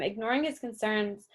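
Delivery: ordinary delivery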